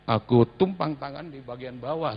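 usual speaking voice

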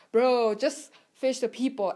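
curt retold put-down; disrespectful tone. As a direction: brush him off like a rude kid. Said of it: "in a very disrespectful tone".

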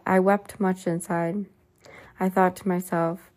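ordinary delivery, nothing marked

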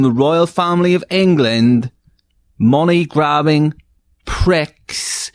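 high, theatrical host rant; game show host. Acted. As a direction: ham it up like a host. Host builds the question.

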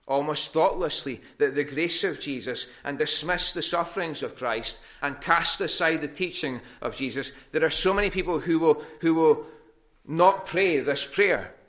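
preacher denouncing those who refuse Christ; preaching at full force. Acted. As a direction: build the rebuke up to its peak. Preaching against hardened hearts.

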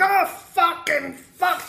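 high, drawn-out gleeful swearing; Billy Connolly. Retold or imitated. Imitating a comedian's glorious swearing to a friend, high drawn-out cursing. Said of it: "it was glorious to hear him go like".

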